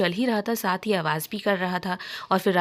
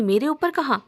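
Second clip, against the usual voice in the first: higher, alarmed question; mildly scared; 6 semitones higher, 3 dB louder than usual. A narrator's reading. Meghna hears the lizard is above her, mildly scared. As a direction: ask with a touch of panic. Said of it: "मेघना डरते हुए कहती".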